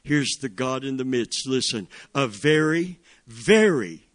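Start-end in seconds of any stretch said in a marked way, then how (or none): 2.2-4.1 "very, very" hit hard and loud; stress on very. Shown as a demonstration. Preacher stressing the psalm to the congregation, punched "very, very". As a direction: lean hard on each "very". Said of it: "emphasis here".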